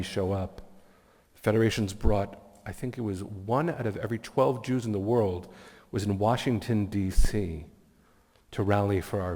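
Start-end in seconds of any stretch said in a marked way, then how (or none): none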